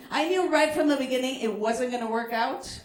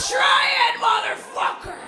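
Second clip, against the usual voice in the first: higher, louder threat, thrown back hard; defiant shout; 9 semitones higher, 3 dB louder than usual. Retold or imitated. A comic threatening the phone thief, yelling the dare. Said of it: "Which means".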